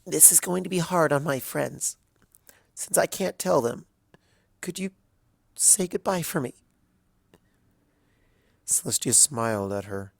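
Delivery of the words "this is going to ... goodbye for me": tearful request. A narrator's reading. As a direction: ask her last favour through tears. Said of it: "tears still streaming down her face".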